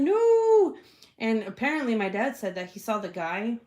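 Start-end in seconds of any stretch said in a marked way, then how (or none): 0.0-0.8 high, loud drawn-out "no"; dramatic cry. Retold or imitated. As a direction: wail it after someone running into danger. Retold cry after running dad.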